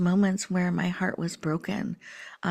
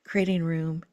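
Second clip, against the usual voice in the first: guided meditation. Acted. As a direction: breathe them gently into their hearts. Leads breathing and heart meditation.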